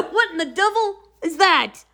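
high-pitched mock bewilderment; amused, baffled exclamation; retold astonishment. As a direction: imitate a friend's comic shock. Boyfriend's startled reaction, retold.

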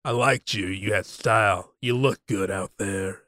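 low, quiet, deliberate threat; a hint of Bunk's quiet menace. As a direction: drop the voice low and lean in. Bunk, acted quote.

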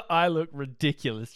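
loud laugh through the words. Real, not acted. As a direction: laugh at yourself.

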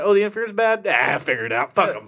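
mock a lazy shrug of a leader; careless dismissal. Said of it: "He's like".

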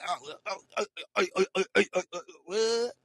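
stuttering oh oh oh, rising to a loud high what; malfunctioning demon. Retold or imitated. Demon people glitching when caught, mock stutter. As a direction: glitch like a broken machine. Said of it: "they malfunction right now".

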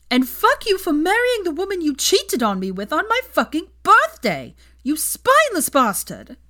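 very high, loud screaming fury; furious scream. A narrator's reading. Hermione screaming at Ron after punching him. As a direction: scream it with everything.

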